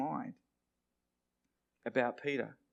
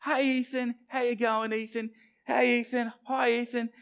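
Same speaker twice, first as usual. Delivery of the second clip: high, singsong playground greetings; a string of high-pitched kid hellos; kids calling out; 9 semitones higher, 3 dB louder than usual. Retold or imitated. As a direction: imitate schoolkids greeting a friend in a high voice. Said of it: "there was some kid going".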